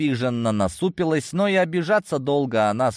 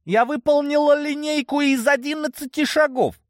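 indignant. Acted. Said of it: "Она фыркнула".

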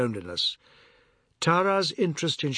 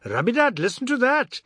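high, louder call full of glee; gleeful cry; 9 semitones higher, 4 dB louder than usual. A narrator's reading. Ajoda calling Rabidat to share the joke, high gleeful call. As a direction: call the others over to laugh. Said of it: "Ajoda cried".

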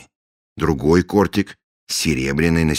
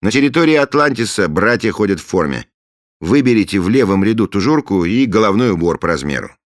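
commanding; 7 semitones higher, 7 dB louder than usual. Acted. Commanding voice from another room.

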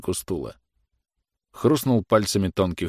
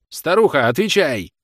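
loud, high-pitched demand; officer demanding an answer from an old woman; harsh order; 8 semitones higher, 6 dB louder than usual. A narrator's reading.

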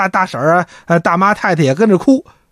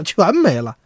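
ordinary delivery

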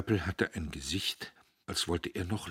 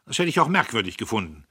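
fast, loud and higher sneer; scornful; 5 semitones higher, 11 dB louder, faster than usual. Acted. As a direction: toss it off with contempt. Diederich höhnt. (schnell und höhnisch)

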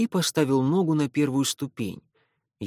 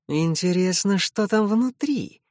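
covet the treasure in the chests; greedy; 7 semitones higher than usual. A narrator's reading. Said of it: "с жадностью в голосе спросил Лео". (с жадностью)

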